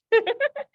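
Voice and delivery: short high giggle; a quick laugh up high. Real, not acted. Host laughing at her husband's reaction, short high giggle.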